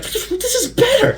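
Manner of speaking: hammed-up awestruck delight; excited gushing. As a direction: gush like a kid who just loved the film. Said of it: "I was like".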